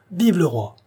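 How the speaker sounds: cri d'acclamation. A narrator's reading. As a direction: cry it out high, as a loyal officer to a crowd. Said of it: "monsieur de Choiseul cria". (cri de ralliement, voix haute)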